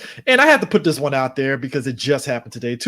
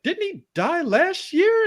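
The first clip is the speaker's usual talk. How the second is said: much higher, slow puzzled question; confused surprise; 14 semitones higher, slower than usual. Retold or imitated. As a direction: lift it up in disbelief. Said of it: "I was like".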